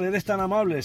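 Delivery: ordinary talk, nothing marked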